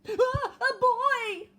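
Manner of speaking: slow, very loud high yell; bear yells. Acted. Bear seeing Eddie, loud frightened yell. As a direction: bellow out in fright. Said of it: "yelled the bear".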